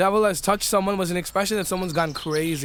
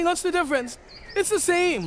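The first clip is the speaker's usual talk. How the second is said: high-pitched mock objection; acted-out incredulous question; incredulous protest; 11 semitones higher than usual. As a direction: argue it as if it's obvious, pitched way up. Acted rhetorical objection, voice jumps up.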